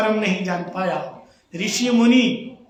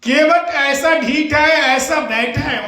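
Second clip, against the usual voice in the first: louder and slow, dwelling on ढीठ; emphatic, slow; 4 semitones higher, 5 dB louder, slower than usual. Shown as a demonstration. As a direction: lean hard into how stubborn he is. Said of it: "इसको ढीठ कहते हैं".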